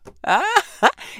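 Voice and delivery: short, high burst of laughter; amused laugh. Real, not acted. Interviewee amused at her accent popping up, high laugh. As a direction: let out a sudden high laugh.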